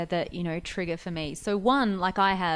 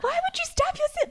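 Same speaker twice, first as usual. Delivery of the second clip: much higher, squeaky disbelief; an only child baffled by sibling violence, pitched way up; incredulous. Retold or imitated. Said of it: "just like".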